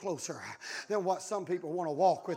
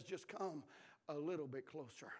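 God speaking softly; 7 semitones lower, 17 dB quieter than usual. Pretend. God calling the listener closer, hushed and low. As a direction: draw them in with a hush. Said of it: "I'm not yelling right now".

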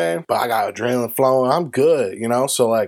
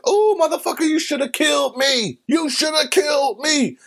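high-pitched retold yell while chasing; 14 semitones higher than usual. Man chasing his shooter, yelling. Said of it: "yelling like".

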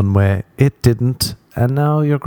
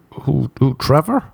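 puzzled wife, imitated; 5 semitones higher than usual. Acts wife's puzzled, suspicious question.

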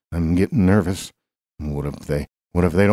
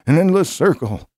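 rising despair; 11 semitones higher, 3 dB louder than usual. Acted. Despair at endless job hunt.

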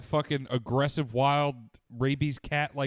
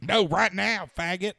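high, loud demanding yell; crackhead lady yell; 7 semitones higher, 4 dB louder, slower than usual. Retold or imitated. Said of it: "I was doing the crackhead lady".

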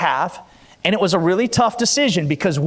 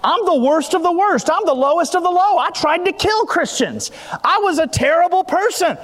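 preaching at full force, raised and high; 4 semitones higher than usual. Acted. Pastor preaching to the church about testimonies, full force. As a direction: fire up the congregation from the pulpit.

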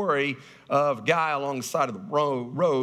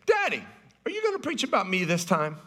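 put-on much higher, a small girl asking her daddy; little daughter voice; 5 semitones higher than usual. Little daughter asking her daddy about the sermon, in a high child voice. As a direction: play the eager little girl. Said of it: "And she said".

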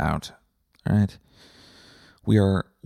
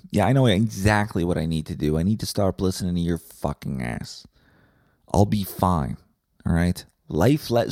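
ordinary delivery

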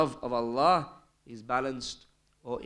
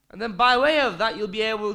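loud, high burst of preaching; preaching climax; 7 semitones higher, 9 dB louder than usual. Real, not acted. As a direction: lift the hall with this line.

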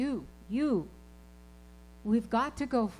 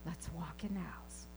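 hushed whisper, much softer; 7 semitones lower, 13 dB quieter than usual. Retold or imitated. Aunt quietly steering nephew inside, whispering. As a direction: whisper it to calm him down. Calming nephew she fears is ill.

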